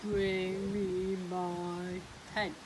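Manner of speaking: very slow, loud giant command; big voice. Acted. Giant demanding his magic hen, big voice. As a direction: boom it out like a giant. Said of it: "he said in a big voice".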